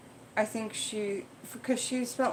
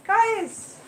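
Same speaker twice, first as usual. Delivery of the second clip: high, loud sudden outburst; startled yell; 8 semitones higher, 4 dB louder than usual. Real, not acted. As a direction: blurt it out as the fight erupts. Cat fight breaks out.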